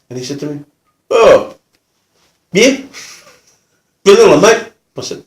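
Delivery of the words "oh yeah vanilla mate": lightly voiced rough bloke, higher and louder; a hint of matey offender. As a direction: imitate a rough customer choosing, with pauses. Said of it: "he was quite a rough gentleman".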